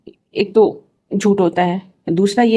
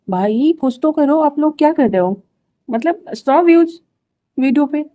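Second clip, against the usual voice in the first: what are you all doing, slowed and pleading; exasperated plea; 6 semitones higher than usual. Real, not acted. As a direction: plead with them, half-joking despair. Pleading with viewers over low views.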